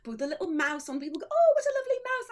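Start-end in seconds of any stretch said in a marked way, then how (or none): 1.3-2.3 high-pitched gushing fan; mock gushing. Fans admiring the little mouse in a signed book, mock gushing.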